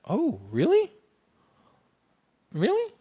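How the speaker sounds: high, rising disbelief; pleasantly surprised. Retold or imitated. Teacher hearing unexpected praise from director, high surprised questioning. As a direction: let each question leap up high.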